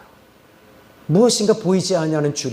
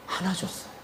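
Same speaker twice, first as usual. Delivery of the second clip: ordinary talk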